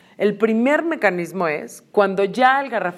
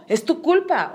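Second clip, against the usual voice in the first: explotar culpando; 9 semitones higher than usual. Acted. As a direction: throw the blame in a raised pitch without shouting. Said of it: "Explotar es". (reproche fingido, lento y agudo)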